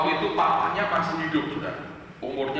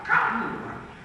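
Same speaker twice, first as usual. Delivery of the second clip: rising from restraint to a higher cry; imitated emotional outburst; 7 semitones higher than usual. Grandpa explains why he stood up, imitated emotional outburst. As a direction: let the held-in feeling break out, moderately. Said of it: "aku tidak tahan kuasai emosiku".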